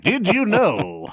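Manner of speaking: slow, high announcer voice; old-timey game show announcer. Shown as a demonstration. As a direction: draw it out like a vintage quiz host. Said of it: "an old-timey game show announcer voice".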